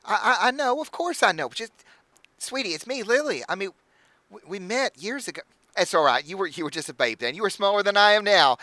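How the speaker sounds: high, gushing hostess voice; bubbly halfling innkeeper. Acted. Innkeeper welcoming the party, high and gushing. Innkeeper reminding Elizabeth of past.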